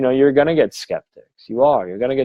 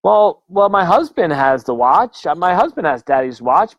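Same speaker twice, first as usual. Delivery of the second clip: imitated client; 7 semitones higher, 3 dB louder than usual. Client admitting to her sister about the watch, high imitated voice. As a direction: play the client turning to her sister, pitched up. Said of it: "she looks at her sister and says".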